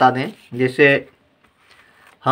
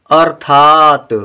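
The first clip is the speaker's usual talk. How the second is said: अर्थात stretched slowly and louder, r clear; slow model reading; 5 dB louder, much slower than usual. Shown as a demonstration. Teacher reads अर्थात slowly for the class to repeat. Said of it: "आइए पहले हम इन शब्दों को पढ़ें".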